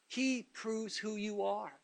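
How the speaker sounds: quiet slow statement. Real, not acted. Preacher telling the church what God does, hushed and slow. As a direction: let it sink in, hushed. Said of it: "You excuse me if I get excited".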